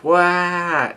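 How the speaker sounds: high, held mock wail; imitated crying out. Narrator retelling Chopper's outburst, imitated crying out. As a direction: wail it like a cartoon crybaby. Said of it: "crying out".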